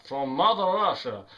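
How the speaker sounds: put-on playful Russian accent. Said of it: "I like doing a Russian accent".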